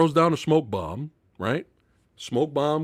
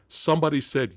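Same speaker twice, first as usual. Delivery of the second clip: ordinary delivery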